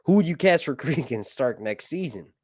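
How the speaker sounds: ordinary talk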